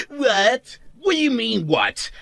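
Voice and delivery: high-pitched, indignant echo; mocking indignation. Husband offended by his wife's curt reply, mocking indignation. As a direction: throw the word back in a high, mocking pitch. Throws wife's word back.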